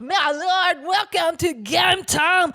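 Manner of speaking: high, very loud, drawn-out put-on shout; over-the-top theatrical game intro; mock grand announcer. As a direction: bellow a hammy welcome, stretching the words. Performs put-on throaty game welcome.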